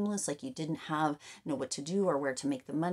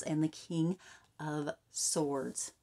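ordinary talk

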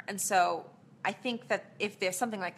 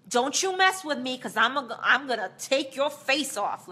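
put-on tough, louder New York accent, higher and threatening; thick New York accent; 6 semitones higher, 7 dB louder than usual. At her Kim Burgess audition, threatening in a thick New York accent. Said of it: "used a really thick New York accent".

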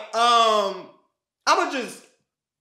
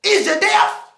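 loud sharp voice; 6 semitones higher, slower than usual. Acted. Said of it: "Why are you yelling".

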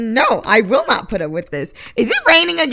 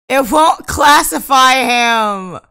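higher, louder, slow shout of frustration; louder, higher, slow complaint at the game; frustrated shout; 4 semitones higher, 7 dB louder, slower than usual. Real, not acted. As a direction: yell it at the game. Game refuses the age-up.

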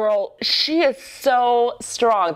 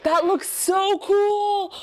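much higher, excited exclamations; thrilled squeals; 12 semitones higher, much slower than usual. Real, not acted. Gushing over a dancer's acrobatic stunt with thrilled squeals. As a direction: gush like you can't believe it.

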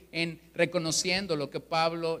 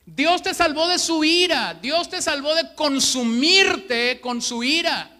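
much higher and louder proclamation; preaching at full force; 8 semitones higher, 7 dB louder than usual. Acted. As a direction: thunder it from the pulpit. Proclaims God's wrath. (proclamando con fuerza)

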